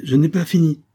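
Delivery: high, emphatic interruption; cutting in. A narrator's reading. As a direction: insist loudly that you hold the floor. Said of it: "lui coupa la parole".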